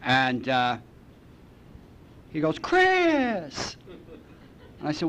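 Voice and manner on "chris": high, bright shout of the name; excited call. Retold or imitated. Jim calls out to Chris from outside, high and excited. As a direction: greet him like a long lost friend.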